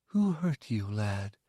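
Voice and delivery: gentle. Acted. The mother asks the hurt boy, soft and concerned. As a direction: ask it kindly. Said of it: "she asked".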